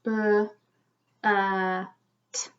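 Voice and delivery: B, uh, t as separate short sounds. Shown as a demonstration. Teacher counting sounds with children, one at a time. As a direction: leave a gap after each sound. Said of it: "How many sounds?".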